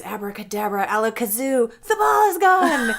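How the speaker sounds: ramp up the spell into a grand reveal; magician patter. Shown as a demonstration. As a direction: clerk performing the genie bottle trick for kids. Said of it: "So I would just go".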